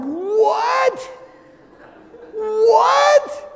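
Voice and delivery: very high, loud, drawn-out disbelief; baffled yell. Retold or imitated. Dad reacts to daughter's bird call, mock baffled yell. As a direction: squawk it in mock alarm.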